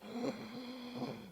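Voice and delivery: sharp, high hammed-up gasp; a playful gasp of pretend horror; mock gasp. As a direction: gasp as if shocked, for laughs. Mock horror at changing channel.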